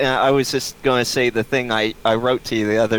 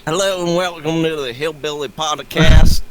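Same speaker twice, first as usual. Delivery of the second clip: put-on hillbilly accent; 2 semitones higher, 5 dB louder than usual. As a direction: open the show like a backwoods host. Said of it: "it's just a funny voice".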